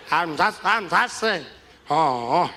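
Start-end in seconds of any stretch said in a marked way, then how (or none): none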